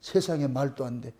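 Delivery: very low, hushed astonishment; stunned disbelief. Acted. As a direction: murmur it as if too amazed to speak up. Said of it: "그냥 놀래버리는 거예요". (놀라서 낮고 작게 읊조리듯)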